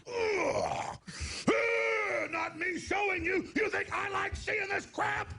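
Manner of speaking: high, slow furious shouting at the TV stations; angry yell. Retold or imitated. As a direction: bellow it like you're fed up with them. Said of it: "I go".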